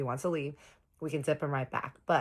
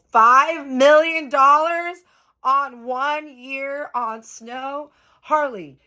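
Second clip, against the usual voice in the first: loud, high, drawn-out disbelief; stunned outburst; 12 semitones higher, 15 dB louder, much slower than usual. Retold or imitated. Woman reacting to Harley's spending confession, stunned outburst. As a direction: stretch each word out in loud shock.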